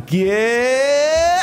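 high, loud emphatic end; debate-show applause bait; emphatic finish. Shown as a demonstration. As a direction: land the last word hard for applause. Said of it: "finished a sentence emphatically like this".

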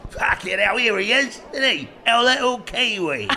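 high, loud Cockney shopkeeper impression; thick Cockney. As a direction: call it out in exaggerated East London Cockney. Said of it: "sort of Cockney, South London".

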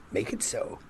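breathy mock Picard order; hushed command to the android; whispered command. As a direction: whisper it like a sultry captain.

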